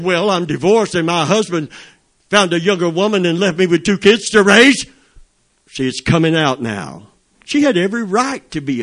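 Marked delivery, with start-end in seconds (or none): 0.0-4.9 high, loud retold outburst of grievance; imitating an upset divorced woman venting. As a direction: let the hurt burst out, raised and indignant.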